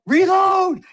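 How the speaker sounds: very high, loud shout; yelling. Retold or imitated. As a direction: bark the order across the field. Retelling yelling reload at son.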